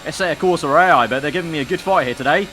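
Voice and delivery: ordinary talk, nothing marked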